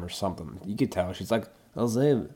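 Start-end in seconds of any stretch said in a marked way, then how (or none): 1.7-2.3 attempted Russian woman's accent, pitched up; a rough go at a Russian accent; female Russian accent. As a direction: imitate a Russian woman introducing herself. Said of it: "can't do a female Russian accent".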